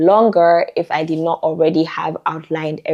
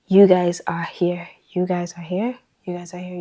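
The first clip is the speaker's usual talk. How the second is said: whispered take; 5 dB quieter than usual. Shown as a demonstration. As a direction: hush it like a secret to viewers. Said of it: "choose the one that I said it the best in".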